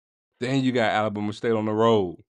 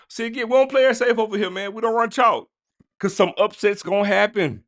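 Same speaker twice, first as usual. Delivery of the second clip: excited; 9 semitones higher than usual. Real, not acted. Hyped predictions, bold upset pick.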